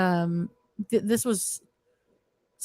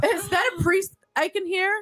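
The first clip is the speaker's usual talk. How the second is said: laughing; 10 semitones higher than usual. Retold or imitated. Mocking the voice in the coffin.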